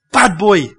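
short scolding with a falling end; rebuke. Shown as a demonstration. Preacher shows how he rebukes his sons, scolding. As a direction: tell off a naughty child. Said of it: "That is rebuke".

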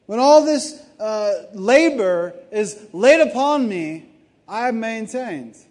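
loud, high, impassioned preaching; Paul's defiant boast of endurance, preached loud and high. Retold or imitated. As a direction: proclaim it like a fired-up apostle. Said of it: "He's like".

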